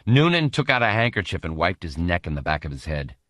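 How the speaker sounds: ordinary delivery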